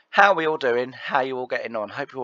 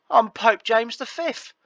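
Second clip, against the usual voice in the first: mock grandeur; 12 semitones higher, 5 dB louder, slower than usual. Joking reply to neighbour.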